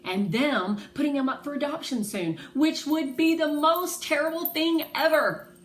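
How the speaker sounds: put-on girl fearing her dog will be given away; upset outburst. A narrator's reading. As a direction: pour out how unfair it is. Child upset about dog's adoption.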